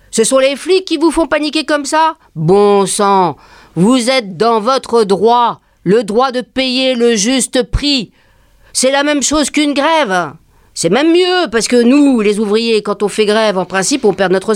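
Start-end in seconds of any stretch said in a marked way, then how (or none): 3.7-10.3 slow, emphatic rallying; slow deliberate persuasion; rallying speech. Retold or imitated. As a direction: spell it out to the crowd. Worker rallying shoppers.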